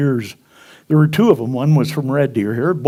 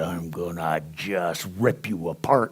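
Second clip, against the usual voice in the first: menacing thought; 10 semitones lower, 11 dB quieter than usual. Retold or imitated. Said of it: "I change it into".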